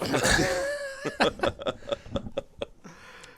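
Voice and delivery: long squealing laugh; high cackling laugh. Real, not acted. Laughing at own plan.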